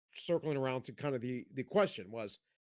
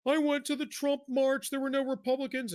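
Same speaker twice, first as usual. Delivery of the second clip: imitating a tearful caller, pitched much higher; hammed-up high whimpering plea; a whiny, teary caller played for laughs; mock crying caller; 11 semitones higher, 4 dB louder than usual. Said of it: "He's like".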